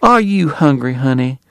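high, coaxing matron; solicitous wife. A narrator's reading. Doctor's wife to the weary guest, solicitous. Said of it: "his wife asked John Henry".

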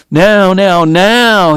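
high, loud urgent pleading; begging the fates at the peak; desperate prayer. Acted. Doc at the piano, begging fate, high urgent plea. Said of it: "he prayed".

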